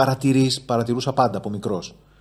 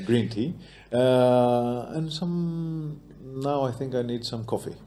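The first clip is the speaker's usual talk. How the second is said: British-leaning accent. Shown as a demonstration. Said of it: "Μου βγήκε πιο πολύ το British". (βρετανική προφορά με νοτιοαφρικανική χροιά)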